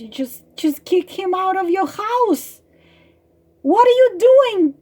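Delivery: exasperated bird building to an outburst; acted exasperation. Bird urges girl to kick him out, exasperated.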